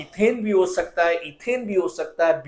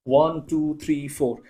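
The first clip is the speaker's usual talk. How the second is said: usual speaking voice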